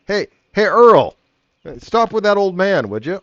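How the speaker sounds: high, insistent holler; calling out. Acted. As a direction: shout over to a colleague. Guard calls to a colleague.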